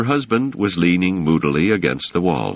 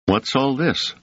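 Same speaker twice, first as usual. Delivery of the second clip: stern; 10 semitones higher than usual. A narrator's reading. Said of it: "said Selden sternly".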